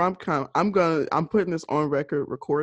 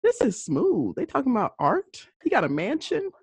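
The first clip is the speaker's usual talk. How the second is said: higher, lightly voiced and impressed; retelling her reaction to the mansion, mock impressed and higher; 6 semitones higher than usual. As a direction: tease it like you're falling for him. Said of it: "I said".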